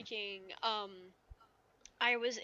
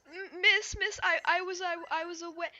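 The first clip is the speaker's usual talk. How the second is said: mock-flustered stammer, higher pitched; flustered pupil; 8 semitones higher, 4 dB louder than usual. Pupil explaining an absence to her teacher, flustered. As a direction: imitate a pupil stumbling to explain.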